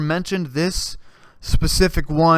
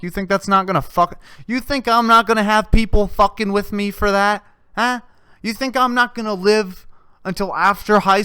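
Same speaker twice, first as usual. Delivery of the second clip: rising rant; 6 semitones higher than usual. Real, not acted. Ranting at the audience.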